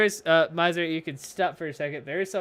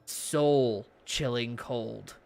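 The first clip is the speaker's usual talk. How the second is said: scene-setting narration; 4 semitones lower than usual. A narrator's reading. Game master opens the scene in hell, low measured narration.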